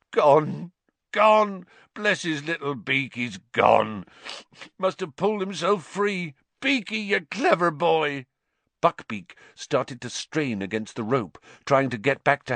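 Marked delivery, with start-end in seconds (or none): 0.1-8.3 high, howling joyful sobs; through sobs. A narrator's reading. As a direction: cry out with tearful relief. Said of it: "Hagrid's words through his sobs".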